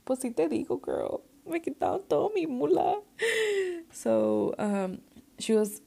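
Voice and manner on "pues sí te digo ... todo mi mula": high, hammed-up wail to mom; mock lament pitched way up; high-pitched retold wail. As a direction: wail it high, half laughing. Retold wailed answer to shocked mother.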